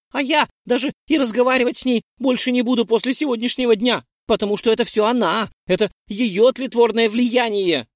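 Джессика swearing off her friend; fervent vow. A narrator's reading. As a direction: rush out the vow. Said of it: "клятвенно зарекалась Джессика".

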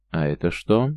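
plain reading voice

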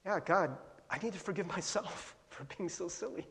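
soft, confessional aside to God; hushed retold prayer with a little laugh; private prayer. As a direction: say it softly, as a personal prayer. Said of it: "just be like".

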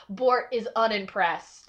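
ordinary talk, nothing marked

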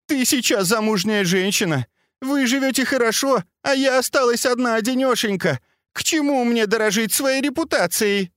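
high frantic shouting; hysterical shouting. Acted. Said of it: "кричала Цзиньгуй".

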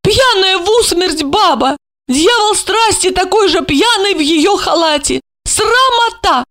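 high-pitched scandalized woman; high indignant character voice. A narrator's reading. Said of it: "возмутилась она".

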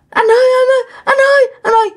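high-pitched, panicked wail of a wife; frightened crying. Retold or imitated. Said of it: "vợ bạn sợ hãi mà đang khóc".

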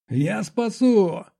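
high, drawn-out desperate yell. A narrator's reading. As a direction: cry out a promise with all urgency. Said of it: "заорал я". (отчаянный протяжный крик)

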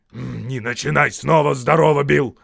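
loud, irritated troll retort; snapping back. A narrator's reading. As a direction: snap back at a nagging mate. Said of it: "огрызнулся он".

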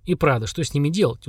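plain reading voice

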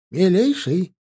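cheerful call. A narrator's reading. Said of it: "повеселевшим голосом позвал официанта".

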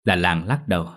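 plain reading voice

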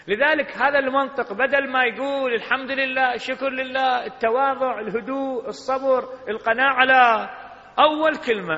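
high and loud, listing virtues; impassioned preaching. Acted. Preacher urging gratitude on the faithful, impassioned. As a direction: build up the list like a sermon climax.

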